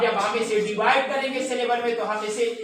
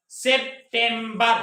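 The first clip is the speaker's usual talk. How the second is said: September slowly split as sep-tem-bar; three separate beats, sep, tem, bar; slow teacher's sounding-out; syllable by syllable; much slower than usual. Shown as a demonstration. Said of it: "अब मैं इसे पढ़ता हूं".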